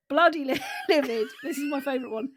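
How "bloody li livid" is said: louder, laughing midway through the word; breaking into laughter. Real, not acted. Reading rising stages of anger to co-host, breaking into laughter. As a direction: crack up while reading it out.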